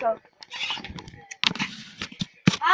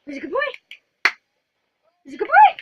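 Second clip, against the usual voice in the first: excited pet talk; 4 semitones higher, 5 dB louder than usual. Real, not acted. Praising the puppy.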